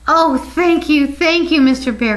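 storybook outburst of relieved thanks; grateful cry. A narrator's reading. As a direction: gush gratefully, pitched well up. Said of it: "cried Edith".

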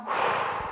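soft breath out through the mouth; breathing-exercise sigh; demonstrated exhale. Shown as a demonstration. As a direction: show a long, voiceless exhale. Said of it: "Out through your mouth".

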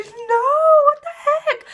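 mock-horrified cry of disbelief; shocked disbelief. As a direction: squeal it like you can't believe the show did that. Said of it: "so I was like".

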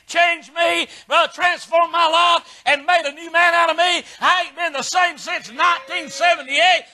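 high, driving revival sermon; fervent preaching. Real, not acted. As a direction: build to a shouted testimony. Preaches at full force.

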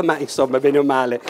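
normal talking voice

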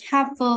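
"Have a" joined as "ha-va"; linking demo, no gap in "Have a"; linked sounds. Shown as a demonstration. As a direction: carry the v of "Have" into "a". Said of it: "những cái nối âm như sau".

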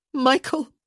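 high, loud, alarmed call. A narrator's reading. Woman to the man who won't let go, panicked. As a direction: call the name sharply in panic. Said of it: "her voice was louder, panicked".

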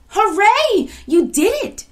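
high, loud cheer; jubilant shout. A narrator's reading. Girl cheering Equia's stopping the troll, jubilant shout. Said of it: "shouted the girl".

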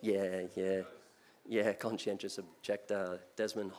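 ordinary talk, nothing marked

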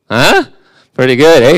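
mock boast. Real, not acted. Mocking his own degree title.

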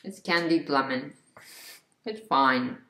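chewing while talking; talking around food; mouth full. Real, not acted. Seen 0: Bit lemon at -7; lips closed, chewing between words after.

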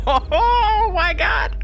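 very high, slow laughing cry; laughing disbelief. Real, not acted.

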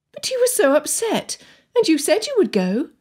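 high-pitched, bewildered protest; confused, pressing. A narrator's reading. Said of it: "Anne pressed, thoroughly confused".

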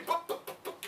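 soft breathless stutter on bu-; stuttering Moses. Acted. Preacher acting Moses stuttering before God. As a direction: catch on each bu- like the word won't come. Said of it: "Moses stuttered".

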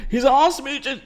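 first stab at Santa, pitched far up. Acted. YouTuber trying a viewer's requested Santa voice, high and put-on. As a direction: try a put-on old Santa, high and odd. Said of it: "in a Santa voice".

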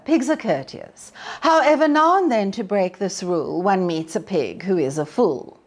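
usual speaking voice

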